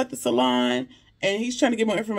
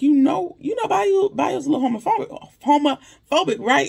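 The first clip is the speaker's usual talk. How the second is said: much higher, louder, dishy tone; high gossipy Maurice; 6 semitones higher, 3 dB louder than usual. Retold or imitated. Maurice gossiping to Sabrina about Bayou, high and dishy. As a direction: lean in and dish it up high.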